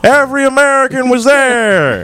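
grand movie-star declaration; stilted old movie melodrama. Retold or imitated. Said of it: "bad, nineteen fifties acting".

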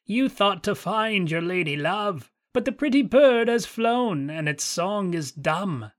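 put-on high, cackling taunt of an old witch; mocking witch. A narrator's reading. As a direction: gloat over the prince's loss. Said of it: "cried mockingly".

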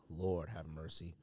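ordinary talk

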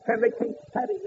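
ordinary delivery

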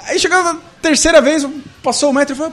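high, worked-up telling; building up to the punchline; excited storytelling. Real, not acted. Excited retelling of the waiter scene.

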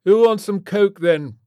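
loud, high party-host rocker; raucous British rock star; aging British rock star. A narrator's reading. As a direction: holler an offer like a washed-up rocker. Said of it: "said Orlin".

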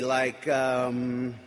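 drowsy on muscle relaxants; slow, loopy, drifting speech. Real, not acted. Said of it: "I feel a little loopy".

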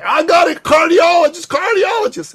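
shouting it very high and loud; eager friend shouting his brainstorm; excited shout. Retold or imitated. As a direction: yell the new plan like a eureka. Quoting Dan's new idea.

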